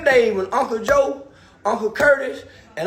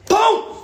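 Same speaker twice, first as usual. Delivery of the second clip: high loud boom for the punch; punch sound; 8 semitones higher, 5 dB louder than usual. Shown as a demonstration. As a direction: shout the hit like a sound effect. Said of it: "with the hand".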